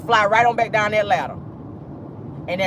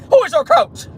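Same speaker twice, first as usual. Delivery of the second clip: mock catchphrase; 5 dB louder than usual.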